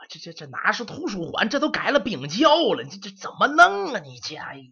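put-on high, loud, sputtering old man; old servant panicking over the frozen library; flustered scolding. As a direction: splutter in alarm at the boy.

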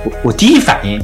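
ordinary delivery

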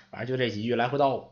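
usual speaking voice

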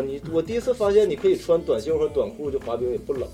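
ordinary talk

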